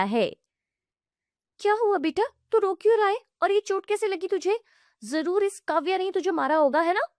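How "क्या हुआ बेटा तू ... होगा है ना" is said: sharp, high, fast accusing aunt; scolding aunt. Acted. Aunt fussing over her hurt son, high and accusing. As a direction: rattle off the blame, pitched up.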